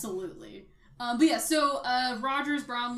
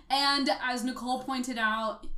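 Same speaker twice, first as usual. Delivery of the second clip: normal talking voice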